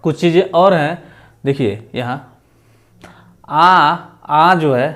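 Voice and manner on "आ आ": आ drawn out slow and open, like the English aw in "ball"; foreign vowel आ demo. Shown as a demonstration. Teacher to students, showing the borrowed vowel आ, slow and drawn out. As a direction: stretch each आ long and round, as in borrowed words. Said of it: "एक आगत या विदेशज स्वर है".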